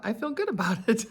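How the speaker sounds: laughing. Real, not acted. Laughs while saying it.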